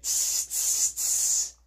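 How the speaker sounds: sharp hissed "tss" sounds; repeated voiceless "tss, tss"; monkey chatter. A narrator's reading. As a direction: imitate monkeys with short tongue-tip hisses. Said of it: "and they said".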